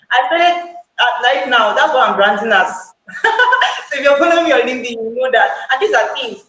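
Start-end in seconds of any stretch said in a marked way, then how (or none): 3.1-3.8 high burst of giggles; laughing. Real, not acted. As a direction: laugh lightly at yourself. Amused aside about her branding.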